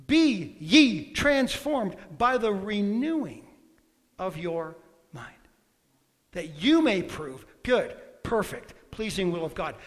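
slow, weighty reading of a verse; slow scripture recital. Acted. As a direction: draw out each word like holy writ. Scripture quoted slowly.

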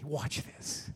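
hushed, gentle whisper. Acted. Jesus gently whispering to the boy. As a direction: breathe it gently to the child. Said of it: "Just gently".

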